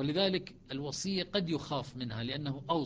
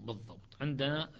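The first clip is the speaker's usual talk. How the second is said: normal talking voice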